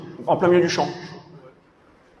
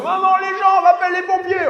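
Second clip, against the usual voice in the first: put-on whiny kid voice. Voicing trapped son calling mother.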